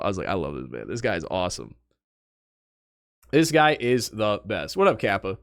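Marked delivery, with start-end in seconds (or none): 0.3-0.9 much lower, softer mock fanboy gush; geeking out. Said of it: "I was geeking out". 0.9-1.8 lower, softer mock gush on "guy"; low, soft, "This guy is awesome" with "guy" leaned on; geeking out. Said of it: "I was geeking out".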